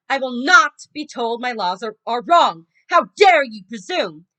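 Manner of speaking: roaring at the defendant; furious shout. Acted. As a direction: shout with royal fury. Furious king at trial.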